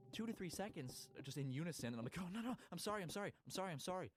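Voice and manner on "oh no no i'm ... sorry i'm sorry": higher, rushed-together repeated apology; mildly panicked pleading; flustered apology. Retold or imitated. Retold apology to woken passengers.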